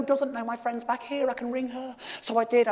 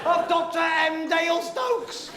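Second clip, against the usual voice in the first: higher, slow, laughing proclamation; thrilled reveal; 3 semitones higher, slower than usual. Real, not acted. Comic unveiling the last Googlewhack to the crowd, thrilled. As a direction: announce it like a grand prize.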